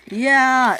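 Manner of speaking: loud, high cheer; gleeful whoop. Acted. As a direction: whoop while speeding along. Caitlin speeds away.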